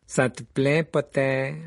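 sonorous, taunting Malfoy voice; ringing taunt. A narrator's reading. Malfoy calls out as Harry approaches, ringing voice. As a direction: project it, drawn toward Potter. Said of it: "lança Malfoy d'une voix sonore".

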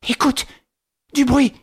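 high, tight alarmed whisper-shout; choked warning; strangled voice. A narrator's reading. Widow to nephew, choking out an alarm. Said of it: "d'une voix étranglée".

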